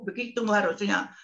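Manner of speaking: usual speaking voice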